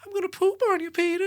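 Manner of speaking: put-on very high, creepy old man's greeting; creepy old neighbor Herbert greeting Peter in a very high voice. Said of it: "He was like".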